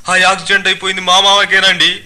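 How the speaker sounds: high-pitched mock-tearful whine; fake weeping. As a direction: pretend to cry over your uncle. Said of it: "అంటూ ఏడవసాగాడు ఈ సింగినాథం". (దొంగ ఏడుపు గొంతుతో)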